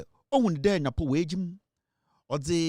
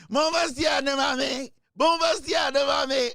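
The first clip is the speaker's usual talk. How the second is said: acted shout; 8 semitones higher than usual. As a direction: bark the order out from above. God shouting orders in sketch.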